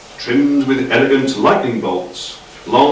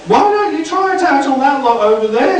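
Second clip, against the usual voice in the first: much higher, fussy correction; squeaky Doc. A narrator's reading. Doc correcting Frank's grammar, high fussy voice. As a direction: pipe up high and prissy.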